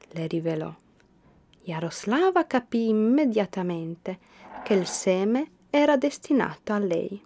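ordinary delivery

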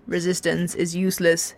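ordinary delivery, nothing marked

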